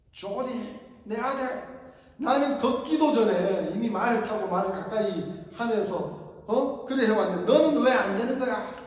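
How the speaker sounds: 높고 크게 다그치는 아버지; 아들을 몰아세우듯 언성을 높여서. Retold or imitated. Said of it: "이러면서 막 화를 내는 거예요". (화가 나서 큰 소리로 나무라듯)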